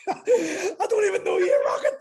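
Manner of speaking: slow, loud, high, laughing shout; laughing mock outrage. Shocked at the name tattoo.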